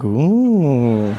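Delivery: long, drawn-out impressed ooh; intrigued ooh. Acted. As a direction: coo as if deeply intrigued. Intrigued reaction to the showman's riddle.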